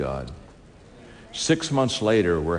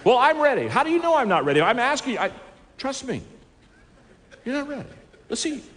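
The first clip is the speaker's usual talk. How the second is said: high, playful put-off; teasing refusal. Retold or imitated. Preacher telling the CEO he is not ready, high and playful. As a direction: tease him and wave it away.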